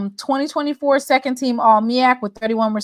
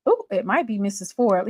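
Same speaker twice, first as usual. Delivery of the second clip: usual speaking voice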